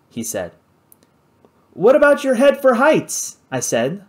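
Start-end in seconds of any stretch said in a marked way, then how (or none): 1.7-3.4 raised, higher voice meant to carry; loudly for her to hear. Acted. Narrator needling Bruce so Kate hears, loud and high. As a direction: pitch it up so the woman in the tree hears. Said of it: "loudly enough for Kate to hear".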